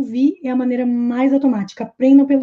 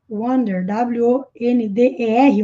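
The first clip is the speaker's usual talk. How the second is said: ordinary talk, nothing marked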